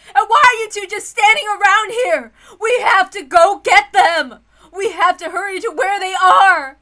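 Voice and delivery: high, loud, tearful urgency; frantic crying. Acted. Ava urging them to fetch her husband, frantic. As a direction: push them to go, desperate. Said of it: "emotions explode even more".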